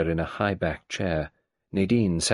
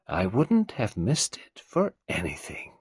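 put-on old tyrant mother; 2 semitones higher than usual. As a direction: play the domineering old woman. Mrs Boynton gloating.

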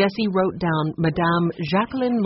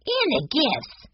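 put-on little boy voice; 9 semitones higher, slower than usual. A narrator's reading. Benny chimes in.

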